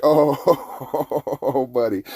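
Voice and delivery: drawn-out gloating laugh on the words. Retold or imitated. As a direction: laugh through it, relishing the turnabout. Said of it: "I was the one laughing".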